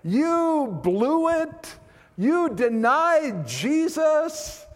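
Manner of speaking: high, drawn-out heckle at Peter; mock jeering. Congregation mentally booing Peter at the verse, mock jeering. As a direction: boo him with long, swooping scolding words. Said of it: "not supposed to boo and hiss".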